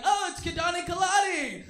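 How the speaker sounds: imitating excited fans, high and loud; hammed-up cry of recognition; mock excited greeting. People recognizing the comic's name, mock excited greeting. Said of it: "Usually when people are like".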